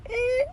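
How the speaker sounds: very high squeaky creak; imitated floorboard creak. Next man steps on same wood, squeaky creak. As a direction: squeal like a loose board.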